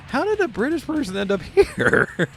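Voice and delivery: high, laughing incredulous question; baffled disbelief. Retold or imitated. Recalling the drive-thru girl's accent, baffled high question. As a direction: pitch way up, bewildered and amused.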